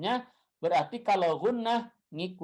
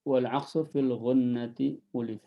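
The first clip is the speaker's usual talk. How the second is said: drawn-out dictation chant; writing it on the board; slow recitation; 5 semitones lower, much slower than usual. Shown as a demonstration. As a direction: spell the verse out slowly. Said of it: "dictating verse while writing".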